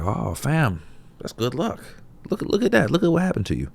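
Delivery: high, excited gossiping neighbor; nosy neighbor voice. Retold or imitated. Neighbor congratulating the farmer on new horses, high excited voice. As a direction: gush at him like a nosy neighbor.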